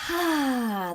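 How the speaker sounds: loud, high, long "ah" sigh; loving sigh. Shown as a demonstration. Guide showing a group the loving feeling, big sigh. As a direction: let out a big contented sigh. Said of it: "that sigh".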